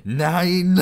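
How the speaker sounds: loud high cry of "Nine!"; shouted "Nine!". Acted. Hitler cries out while jumping before the ogre, shouting. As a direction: yell it as he leaps in front.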